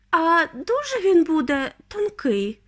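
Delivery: high, slow anxious question; concerned, drawn-out high voice; worried mother. A narrator's reading. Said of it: "стурбувалася пані Тіві".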